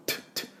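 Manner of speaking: トゥ said as a soft puff, breathy with no voice; puff of air. Shown as a demonstration. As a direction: spit out トゥ without spitting. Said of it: "出さずに出すような音を作る". (トゥ、唾を出さずに息だけで)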